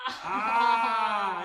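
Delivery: loud strained cry of effort; real effortful shout. Real, not acted. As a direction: cry out in the stretch. Student straining in splits.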